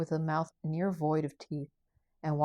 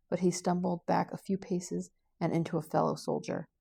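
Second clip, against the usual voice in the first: usual speaking voice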